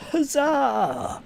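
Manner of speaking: squeaky, high-pitched huzzah; a thin, falsetto victory cry; high cheer. Pretend. Celebrating one viewer.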